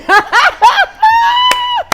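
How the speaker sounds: very high, loud shouted laughter; shrieking laugh. Real, not acted. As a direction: burst out laughing at the joke. Big laugh at wine joke.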